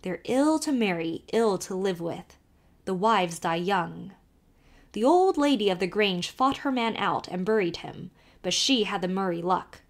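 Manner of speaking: put-on lively Irish accent, calling to the horse; old Irish driver calling out; Irish carter. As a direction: urge the nag on in a brogue. Kelly warns Emily.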